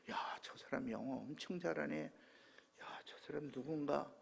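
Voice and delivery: soft, retold murmur of admiration; hushed wonder. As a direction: marvel quietly to yourself. Retold marvelling at a figure in vision. (나직이 감탄하는 혼잣말)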